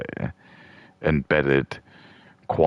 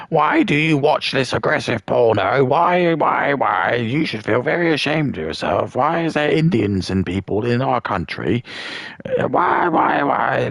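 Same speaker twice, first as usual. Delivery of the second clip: mocking a shrill woman; 8 semitones higher than usual. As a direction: sneer it out like a cartoon scold lecturing strangers. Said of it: "being like".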